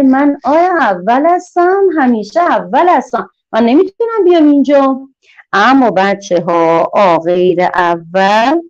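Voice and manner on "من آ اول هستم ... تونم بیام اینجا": a lightly voiced, higher, boastful little letter; proud letter character. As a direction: play the proud first letter who won't come. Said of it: "آ اول میگه".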